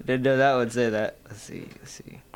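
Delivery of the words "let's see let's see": hushed mutter to self; whispering. Real, not acted. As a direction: whisper it under your breath. Muttering to self while searching.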